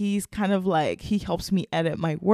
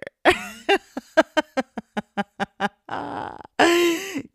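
embarrassed laugh; 11 semitones higher, 5 dB louder than usual. Real, not acted. Laughing after her confession.

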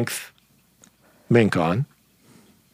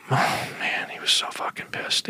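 hushed, softer whisper; 9 dB quieter than usual. Real, not acted. Teller whispering about the furious gunman. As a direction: drop to a whisper for effect.

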